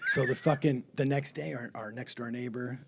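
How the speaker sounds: usual speaking voice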